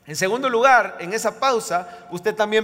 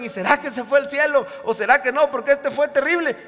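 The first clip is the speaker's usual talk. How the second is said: higher, louder, anxious-sounding mock doubt; raised voice piling up questions; 7 semitones higher, 5 dB louder than usual. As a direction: imitate people fretting with doubts. Acts doubters questioning a loved one's salvation.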